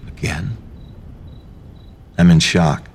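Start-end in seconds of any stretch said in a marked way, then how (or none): 0.1-0.6 mumbling. A narrator's reading. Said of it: "I mumble to myself".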